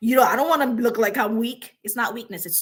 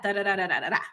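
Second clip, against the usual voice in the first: usual speaking voice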